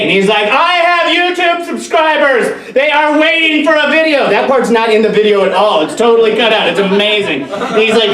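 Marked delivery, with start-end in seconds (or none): 0.5-4.3 indignant protest. Acted. YouTuber defending himself to his girlfriend, indignant. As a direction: declaim it as an affronted hobbyist, pitch way up.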